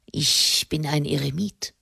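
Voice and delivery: low, soft, obstinate reply; stubborn. A narrator's reading. Hermit refuses Katharina's advice, stubborn. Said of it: "versetzte William Fleet halsstarrig".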